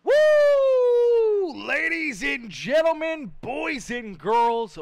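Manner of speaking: big booming announcer call, high and loud; booming kids show opener. Acted. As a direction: belt out the welcome like a showman. Race broadcast opening call.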